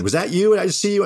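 high, loud excited question; excited friends. Retold or imitated. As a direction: mimic friends who can't believe it.

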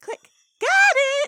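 very high, loud triumphant yell; gleeful shout. Retold or imitated. Pony photographing a monster, shrieking with glee. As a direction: shout it like you just caught the shot. Pinkie snapping photo amid screams.

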